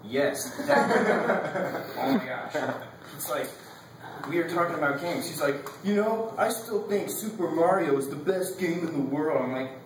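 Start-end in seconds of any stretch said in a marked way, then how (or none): 5.8-9.4 higher put-on earnest voice; classmate impression. Student mimicking classmate praising an old game, mocking. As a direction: mimic a classmate's silly opinion, pitched up. Imitating classmate talking about games.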